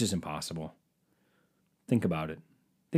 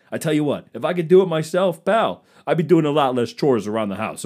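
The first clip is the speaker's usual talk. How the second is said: loud, higher hammed-up stand-up voice; hack comedian; 5 semitones higher, 7 dB louder than usual. Comic parodies a cheesy club comic, loud and hammy. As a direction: play the cheesy club comic working the room.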